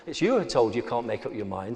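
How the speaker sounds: ordinary talk, nothing marked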